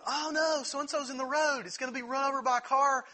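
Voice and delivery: high, fast mock alarm from the toys; hammed-up toy panic. Toys panicking in retold scene.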